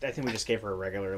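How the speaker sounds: ordinary talk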